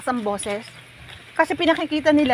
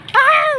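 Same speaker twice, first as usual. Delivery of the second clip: exasperated yelp; 14 semitones higher, 5 dB louder than usual. Real, not acted. Dismay the walk is still far.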